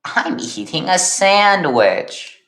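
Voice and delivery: high, slow, drawn-out silly voice; Happy Man. Acted. Happy Man at a picnic answering Josh, in a high drawn-out voice. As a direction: stretch it out high and swooping. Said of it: "says Happy Man".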